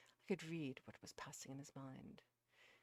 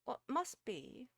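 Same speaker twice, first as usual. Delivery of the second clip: slow, high, resigned servant woman; philosophical; 10 semitones higher, 8 dB louder, slower than usual. A narrator's reading. Missus Beale accepts fate about dinner, philosophical. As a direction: draw it out as a piece of wisdom. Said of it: "added Missus Beale philosophically".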